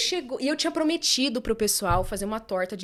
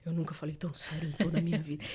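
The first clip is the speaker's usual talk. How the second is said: lightly lower, solemn imitation of Fernando; Fernando's way; 5 semitones lower, 5 dB quieter than usual. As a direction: say it low and grave like him. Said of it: "vocês conhecem o Fernando falando".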